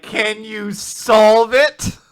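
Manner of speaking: drawn out, slow and loud; slow mock question. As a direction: sarcastically spell out the question. Mocking a worksheet prompt.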